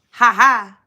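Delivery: ordinary delivery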